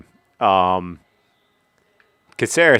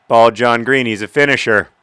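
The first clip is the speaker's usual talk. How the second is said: louder, pumped-up praise; excited cheer; 3 semitones higher, 7 dB louder than usual. Real, not acted. Gamer cheering his penalty scorer, louder.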